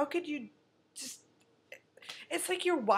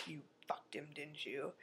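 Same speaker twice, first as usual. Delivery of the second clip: low hushed whisper, much softer; accusing whisper; 7 semitones lower, 15 dB quieter than usual. Retold or imitated. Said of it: "she's like".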